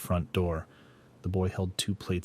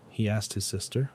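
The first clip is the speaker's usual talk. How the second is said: plain reading voice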